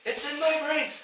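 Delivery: distressed bird creature with a human voice; pleading crow-person. Acted. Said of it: "It's a human voice repeating".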